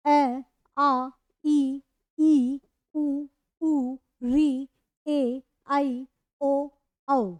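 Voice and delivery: each vowel spoken slowly and clearly, one by one; teacher reads the vowel row aloud slowly for the class, drawn out and clear; reciting the vowels. Shown as a demonstration. The teacher recites the vowels to students, slow and clear. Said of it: "ग्यारह स्वर ये लिखे हुए हैं".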